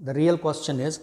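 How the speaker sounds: ordinary delivery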